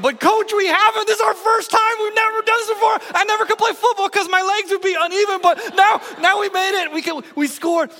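put-on high, loud, breathless kid shouting; excited protest. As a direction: protest like an overjoyed teenager. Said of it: "I said to him".

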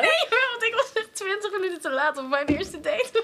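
high, slow giggly confession; laughing. Real, not acted. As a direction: admit it while laughing. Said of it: "dan mag jij niet lachen". (lachend opbiechten)

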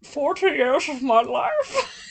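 lightly hammed-up old Scottish drawl; mock Connery lament; old Sean Connery. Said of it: "He talks like old Sean Connery".

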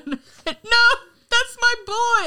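high, loud imitated lament; mock grief. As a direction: cry out in hammed-up anguish. Acts out grieving text.

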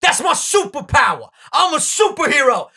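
high-pitched [name] imitation; [name] impression. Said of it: "impressed with my impression".

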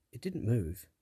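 ordinary delivery

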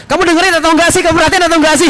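wife scolding her husband for not listening, mock annoyed; nagging wife. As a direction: play the exasperated wife demanding attention. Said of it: "dimarahin sama perempuan".

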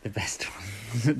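mocking whisper. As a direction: breathe it out slowly.

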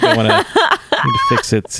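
high, slow, laughing toy voice; slow warped pull-string toy; broken toy voice. Retold or imitated.

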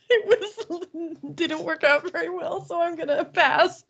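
high, laugh-choked voice. Real, not acted. Declining to try another accent after a failed one, laughing. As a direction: talk through giggles.

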